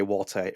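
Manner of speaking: "water" with a clear t, WAW-tuh; British pronunciation of water. Shown as a demonstration. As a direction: say "water" British-style, t not softened. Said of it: "that's not how I say".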